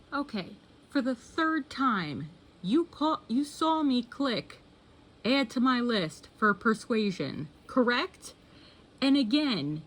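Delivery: high-pitched, drawn-out reproach; exasperated. Real, not acted. Addressing the app about repeated suggestion.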